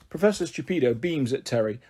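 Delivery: usual speaking voice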